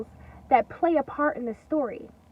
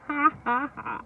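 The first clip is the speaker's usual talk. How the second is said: mock laugh.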